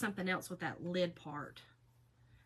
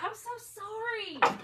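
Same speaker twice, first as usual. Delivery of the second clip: higher, slow, sorry-sounding coworker; sympathetic neighbor; 12 semitones higher, slower than usual. Retold or imitated. Neighbor apologizing about her back, slow, gently mimicked. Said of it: "He was like".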